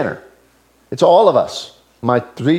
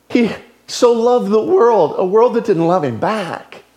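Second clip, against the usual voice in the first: ordinary delivery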